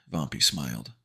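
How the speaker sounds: plain reading voice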